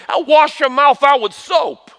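high, loud, drawn-out mock threat; hammed-up scolding parent; mock parental threat. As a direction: imitate a parent laying down the law.

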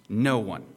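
"No one" hit loud and firm; loud, weighty stress on "No one"; emphatic. Shown as a demonstration. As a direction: punch "No one" as a standalone declaration. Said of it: "emphatic. It's emphasizing".

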